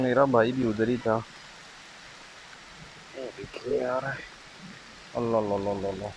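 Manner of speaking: ordinary talk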